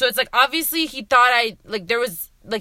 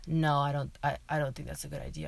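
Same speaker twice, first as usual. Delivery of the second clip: low, soft reluctant protest; hesitant, much softer and lower; reluctant mumble; 8 semitones lower, 11 dB quieter than usual. Retold or imitated. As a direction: voice a drunk kid quietly balking. Voicing the drunk, reluctant date.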